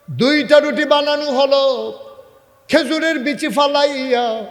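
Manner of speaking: high, loud sustained chanting narration; full-force preaching. A narrator's reading. Preacher tells of Omar cooking bread, full-force chant.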